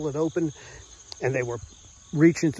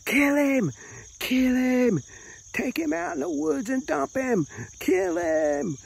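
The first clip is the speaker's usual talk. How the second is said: put-on screeching old ladies; 12 semitones higher than usual. Old women in the mob urging the gang on, high shrill shriek. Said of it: "old lady voices screeching".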